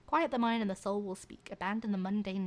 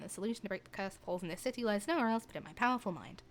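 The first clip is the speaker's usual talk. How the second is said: normal talking voice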